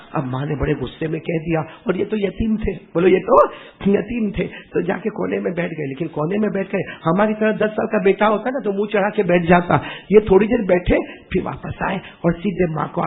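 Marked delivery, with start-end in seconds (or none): none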